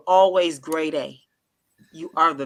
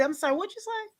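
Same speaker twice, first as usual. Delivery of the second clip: mock surprise; 13 semitones higher, 8 dB quieter than usual. Acting surprised at date.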